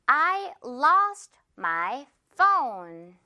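I lost my phone spoken word by word, very slowly; slow classroom model sentence; very slow demo. Shown as a demonstration. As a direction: spell out each word slowly for the class to repeat. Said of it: "먼저 첫 번째 문장".

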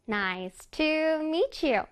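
"Nice to meet you" drawn out note by note; very slow, sing-song "Nice to meet you"; piano-like intonation. Shown as a demonstration. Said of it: "음을 넣어서 한번 다시 읽어볼게요".

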